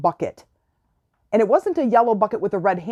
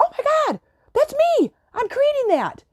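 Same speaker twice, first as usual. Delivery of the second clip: much higher ecstatic cry; excitement. Pretend. Realizing they created the pink giraffe, high ecstatic squeal. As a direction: squeal it, overjoyed. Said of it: "That's the excitement".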